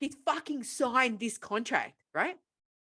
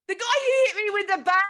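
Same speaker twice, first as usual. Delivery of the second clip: higher and louder incredulous rant; louder, higher comic outrage; outraged exclamation; 8 semitones higher, 7 dB louder than usual. Real, not acted. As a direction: shout it in mock outrage. Indignant joke about being hit.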